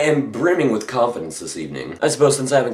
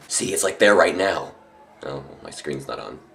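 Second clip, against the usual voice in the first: ordinary delivery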